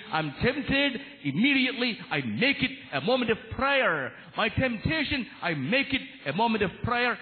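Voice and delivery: high, ringing sermon delivery; fervent preacher; impassioned preaching. Real, not acted. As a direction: proclaim it to the congregation, pitched high and emphatic. Preaching at full force.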